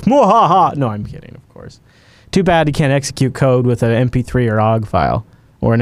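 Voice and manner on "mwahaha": hammed-up evil villain cackle; a mock-evil laugh at his own scheme; sneering panto villain. Jokes about infecting podcast files.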